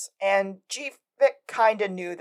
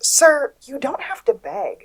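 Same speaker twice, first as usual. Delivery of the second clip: slower, higher teasing tone; lightly voiced smug boss; mock smug chief; 7 semitones higher, 5 dB louder, slower than usual. Chief on the phone with the begging mayor, mock smug. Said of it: "at some point goes".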